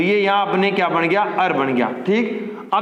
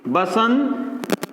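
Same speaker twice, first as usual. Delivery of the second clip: slow spelled-out repeat; much slower than usual. Shown as a demonstration. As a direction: stretch बसंतर्तु out slowly so the class can follow. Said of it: "देखो बसंत plus ऋतु". (बसंतर्तु धीरे-धीरे खींचकर)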